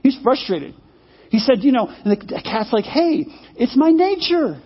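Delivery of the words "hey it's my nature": high, drawn-out mock protest; protesting excuse. Cat to frustrated horse, high protesting excuse. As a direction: plead your case in a raised voice.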